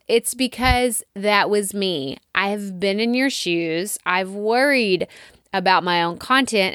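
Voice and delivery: usual speaking voice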